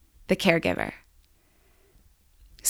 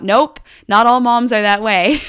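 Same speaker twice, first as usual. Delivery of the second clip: louder mock yell from the next room; husband yelling out; 4 semitones higher, 7 dB louder than usual. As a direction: call it out loud from the kitchen. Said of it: "in the kitchen yells out".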